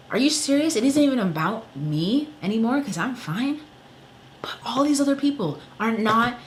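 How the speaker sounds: real outrage at being ignored; exasperated outrage. Real, not acted. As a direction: let the disbelief burst out. Outraged rant at medical care.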